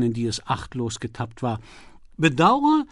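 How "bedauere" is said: prim refusal. A narrator's reading. Lady refuses at the door.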